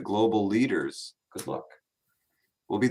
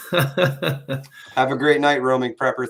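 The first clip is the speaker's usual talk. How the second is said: laughing slow sign-off; 5 semitones higher, 6 dB louder than usual. Real, not acted. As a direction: laugh through the goodbye.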